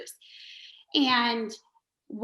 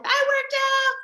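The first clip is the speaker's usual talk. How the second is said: excited mimicry; 14 semitones higher than usual. Mimicking member's morning post.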